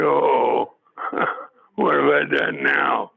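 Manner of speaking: chuckling through a mock-panicked thought; laughing mock worry. Retelling being called to the boss, laughing. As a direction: laugh while faking alarm.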